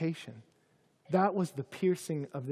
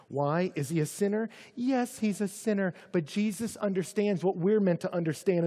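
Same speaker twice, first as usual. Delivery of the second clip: ordinary talk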